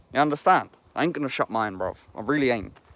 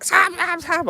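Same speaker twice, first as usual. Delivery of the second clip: put-on much higher, urgent girlfriend calling his name; girlfriend waking him; slower than usual. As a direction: call his name high and alarmed, like a startled partner. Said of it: "And she's woke up like".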